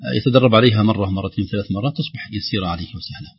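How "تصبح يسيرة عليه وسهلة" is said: normal talking voice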